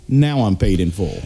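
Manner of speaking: villain's low line. Retold or imitated. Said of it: "says in front of the kid".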